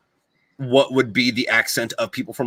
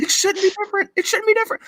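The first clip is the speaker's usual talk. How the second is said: exasperated; faster than usual. Real, not acted.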